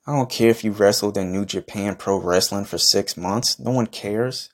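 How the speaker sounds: usual speaking voice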